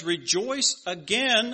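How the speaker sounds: "Rejoice again" slow, each word pressed; emphatic. Shown as a demonstration. Pastor urging the congregation, slow and emphatic on "again". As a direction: lean hard on "again" in "Rejoice again". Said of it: "Very emphatic about it".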